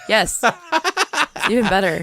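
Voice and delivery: laughing. Real, not acted. Laughing at the name swap.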